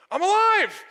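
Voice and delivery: high, loud joyful shout; man in the church video shouting for joy; ecstatic cry. Retold or imitated. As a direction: burst out like waking to a miracle. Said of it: "he goes".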